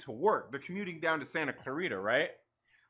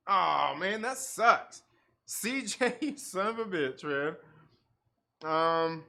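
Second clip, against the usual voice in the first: normal talking voice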